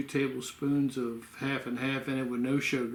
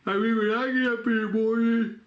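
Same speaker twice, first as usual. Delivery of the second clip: words stretched through a real yawn, higher and louder; 9 semitones higher, 5 dB louder than usual. Real, not acted. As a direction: talk right through the yawn. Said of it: "excuse me for yawning".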